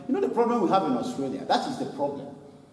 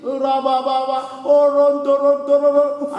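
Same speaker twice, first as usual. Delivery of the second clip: louder, chanted gibberish prayer; mock tongues prayer; 6 semitones higher, 7 dB louder than usual. Preacher mimics the serious praying congregation, loud chanted tongues. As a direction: imitate intense prayer in tongues, half-mocking. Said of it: "they were all very serious praying".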